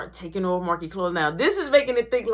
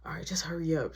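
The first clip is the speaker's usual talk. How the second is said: whisper; 5 semitones lower, 7 dB quieter, faster than usual. Retold or imitated. Hushed phone.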